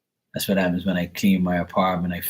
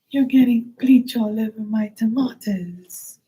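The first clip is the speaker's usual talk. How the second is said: high, whiny mock woman; mocking the neighbor. Repeating the neighbor's complaint, high and whiny. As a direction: squeal it high like she did. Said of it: "repeats neighbor's line".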